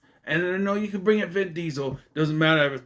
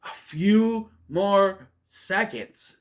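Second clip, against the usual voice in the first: very slow and higher, each word stretched; drawn-out emphasis; 6 semitones higher, 4 dB louder, much slower than usual. Real, not acted. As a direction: stretch every word out for dramatic effect. Dramatizes how close the win was.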